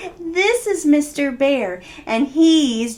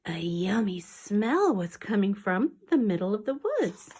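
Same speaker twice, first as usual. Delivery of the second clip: soft, hushed storytelling whisper; whispered story reading; 3 semitones lower, 9 dB quieter than usual. Acted. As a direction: read the tale in a secret whisper.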